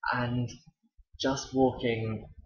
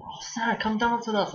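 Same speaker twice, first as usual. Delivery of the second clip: much higher, imitating the kids begging him to dance; mock kid voice, pitched way up, coaxing; pleading pupils; 13 semitones higher, 4 dB louder than usual. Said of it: "the fourth formers ... said".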